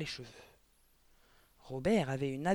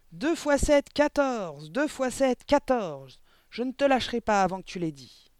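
high, full-voiced furious yelling; vociferating; 7 semitones higher, 8 dB louder than usual. A narrator's reading. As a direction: yell it into the pupil insistently. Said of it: "mademoiselle Le Gourdin vociférait". (vociférations furieuses)

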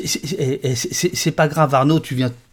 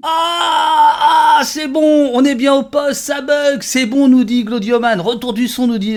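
real burst of relief, much higher and louder; relieved excitement; 10 semitones higher, 5 dB louder, slower than usual. Real, not acted. Host hears the sound come back, excited high shout. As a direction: cry out with joy that it finally works. Sound finally works on stream.

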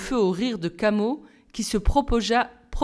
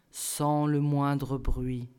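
slow, much softer and lower; hushed refrain; 7 semitones lower, 7 dB quieter, much slower than usual. A narrator's reading. Narrator closing the silent ritual, slow and hushed. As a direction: let it fade to almost nothing.

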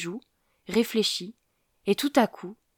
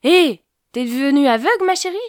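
put-on loud, higher old man's call; vexed shout; 7 semitones higher, 10 dB louder than usual. A narrator's reading. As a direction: yell it out, offended. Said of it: "et vexé, il cria".

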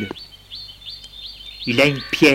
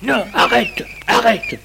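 high, pleading stop; scared old man pleading; frightened plea; 6 semitones higher than usual. A narrator's reading. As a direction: beg him to stop, voice rising. Grandfather begs him to stop.

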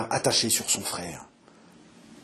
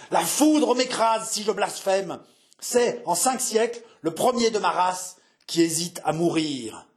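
storming voice; 5 semitones higher than usual. Acted. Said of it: "D'une voix de tempête". (voix de tempête, indignée)

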